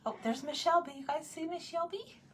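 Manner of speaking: high little pet-talk voice; cooing pet voice. Real, not acted. Streamer spotting the cat on camera, cooing pet voice. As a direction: lift into a sweet, high pet-owner coo. Spots her pet, coos at it.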